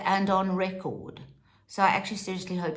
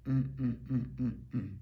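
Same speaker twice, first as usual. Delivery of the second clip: disapproving hum; 8 semitones lower, 7 dB quieter, faster than usual. Real, not acted.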